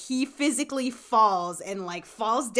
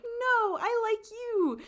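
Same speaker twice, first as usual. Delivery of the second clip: higher, sweet mock reply; mock girl reassurance; 13 semitones higher, slower than usual. Girlfriend reassuring fishing boyfriend, higher mock voice. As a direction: pitch it up as the hoped-for answer. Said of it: "trying to get her to be like".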